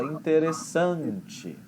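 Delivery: "interessante" building slowly to a punch on SAN; late punch. Shown as a demonstration. Teacher showing Portuguese word stress, punch near the end. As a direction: hold back, then hit SAN in "interessante". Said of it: "esperando a hora de você dar o".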